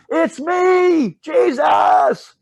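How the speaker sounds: high, loud shout over the wind; hammed-up cry from across a stormy lake; yelling across the water. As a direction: call out from far off so they can hear. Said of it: "He's yelling".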